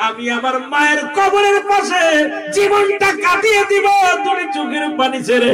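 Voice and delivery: high, chanting plea; impassioned preaching. Acted. Jurain begs to live by mother's grave, impassioned high plea.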